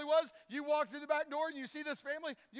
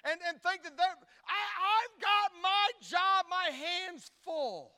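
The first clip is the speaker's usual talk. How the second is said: louder, higher sermon climb; preaching at full force; 7 semitones higher, 8 dB louder than usual. Acted. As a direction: preach it, building to the throne.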